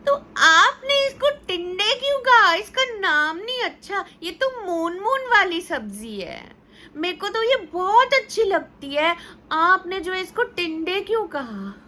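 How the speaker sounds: put-on very high, whiny little girl near tears; child protesting. Daughter asking mother why she called it tinda, high whiny protest. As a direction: plead like a kid who feels tricked.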